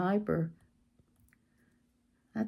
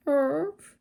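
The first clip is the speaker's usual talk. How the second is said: dog bark; 8 semitones higher than usual. Retold or imitated. Imitating a dog.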